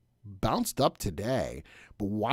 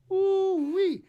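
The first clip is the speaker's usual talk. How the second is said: excited whoop. Real, not acted. Excited about steak dinner.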